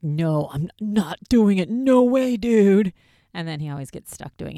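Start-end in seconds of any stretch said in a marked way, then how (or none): 0.0-2.9 loud, high protesting whine; hammed-up scared refusal, rising to a yell; imitating a friend who refuses every dare; mock whiny refusal. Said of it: "Aaron's always like".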